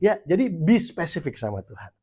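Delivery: usual speaking voice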